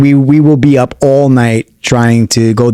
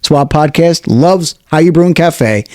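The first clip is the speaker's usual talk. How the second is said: higher, upbeat radio ad voice; ad read; 4 semitones higher than usual. Acted. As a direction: sell the cafe like a radio spot. Continues the sponsor read.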